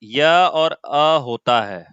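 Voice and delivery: य और अ drawn out slowly, each sound separate; sounding out the letters. Shown as a demonstration. Teacher breaking the sound into य and अ, slowly. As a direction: spell out य and अ slowly for students. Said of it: "इसका pronunciation य होता है".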